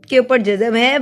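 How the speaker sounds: usual speaking voice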